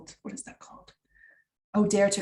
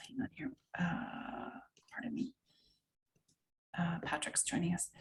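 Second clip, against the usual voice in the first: hushed unvoiced whisper, off-mic; whispered aside; 11 dB quieter than usual. Real, not acted. Presenter whispers an aside as a participant joins. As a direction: drop to a whisper to not disturb the class.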